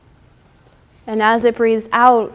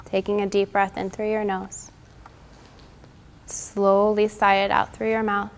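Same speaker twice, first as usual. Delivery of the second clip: guided relaxation; 2 semitones higher, 4 dB louder than usual. Acted. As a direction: talk them gently through each breath. Leads yoga cool-down and breathing.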